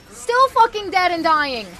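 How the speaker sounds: exasperated rant. Real, not acted. Frustrated at dying.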